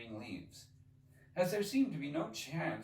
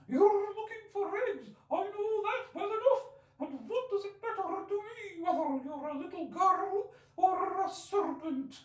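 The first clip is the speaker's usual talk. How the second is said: lamenting pigeon; 7 dB louder than usual. Acted. Pigeon ignoring Alice, fretful and aggrieved. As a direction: complain on and on like a fussy bird.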